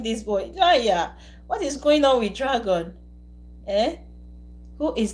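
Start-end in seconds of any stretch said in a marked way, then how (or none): none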